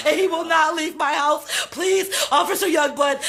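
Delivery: ordinary delivery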